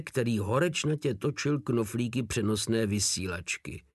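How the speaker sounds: plain reading voice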